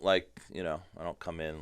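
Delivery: ordinary talk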